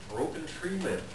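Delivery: ordinary delivery, nothing marked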